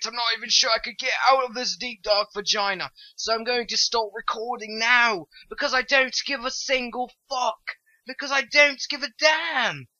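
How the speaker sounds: high, put-on British accent. Player rambling about stopping the recording, British accent. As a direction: declaim it in an emphatic mock British accent. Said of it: "stop talking in a British accent".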